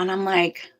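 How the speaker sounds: ordinary delivery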